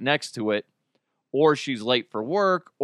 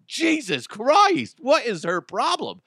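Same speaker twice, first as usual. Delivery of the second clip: exasperated outburst; 10 semitones higher than usual. Retold or imitated. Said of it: "I'm like".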